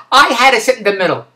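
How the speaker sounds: ordinary delivery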